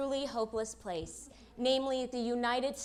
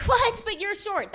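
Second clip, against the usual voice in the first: mock shocked; 8 semitones higher, 6 dB louder than usual. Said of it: "They're like".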